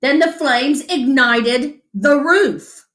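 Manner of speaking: usual speaking voice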